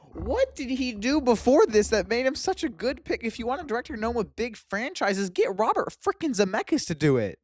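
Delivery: high-pitched, exasperated disbelief; incredulous rant. Real, not acted. Host disputing why the director was picked, incredulous. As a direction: question it in high, baffled outrage.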